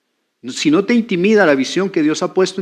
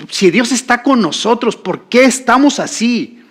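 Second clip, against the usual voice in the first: higher-pitched indignant protest; Gedeón angry with God; 7 semitones higher, 4 dB louder than usual. Acted. Preacher acting a man questioning God, angry. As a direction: protest to heaven in acted exasperation. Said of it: "él se enojó con Dios".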